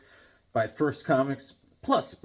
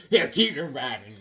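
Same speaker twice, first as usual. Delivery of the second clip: ordinary talk, nothing marked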